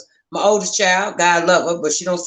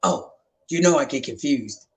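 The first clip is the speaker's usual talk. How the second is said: lightly voiced old dad; 7 semitones lower, 4 dB quieter than usual. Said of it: "he's like".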